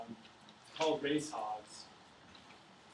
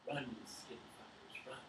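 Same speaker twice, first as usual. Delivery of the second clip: hushed whisper, much softer; whispered urging; 2 semitones lower, 11 dB quieter than usual. Acted. John at the fence urging his hogs on, whispered. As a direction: breathe it out to the hogs under your breath.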